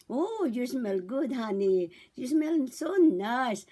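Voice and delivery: slow, cooing praise; mother to baby. Shown as a demonstration. Mother praising her freshly changed baby, slow singsong coo. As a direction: draw it out in baby talk. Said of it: "pag natapos niya napalitan".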